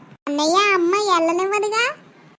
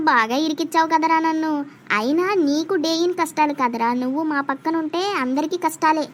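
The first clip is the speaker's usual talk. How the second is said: ordinary delivery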